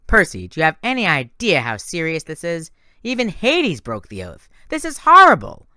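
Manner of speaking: rising to a high, loud horrified cry; alarmed. A narrator's reading. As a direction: panic building over the broken oath. Annabeth alarmed by the news.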